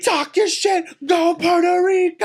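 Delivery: high, drawn-out heckler's yell; imitated screaming. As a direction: holler it like a fan at the back of a show. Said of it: "he's screaming so much".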